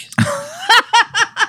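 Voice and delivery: high laughing scream; wild scream. Retold or imitated. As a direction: shriek out the window, cackling. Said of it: "he just screams".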